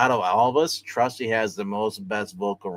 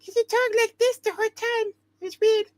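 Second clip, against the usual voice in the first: very high put-on voice; Amy's voice; 3 dB louder than usual. Host recalls freaking out Eric with Amy's voice, very high put-on. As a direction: squeak it high like Amy. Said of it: "Amy's voice tone".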